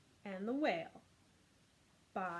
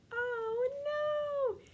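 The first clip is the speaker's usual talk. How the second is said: big high squeal of mock surprise; mock alarm; 6 dB louder than usual. Teacher hamming up the fish being eaten. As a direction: gasp it out like a cartoon fright.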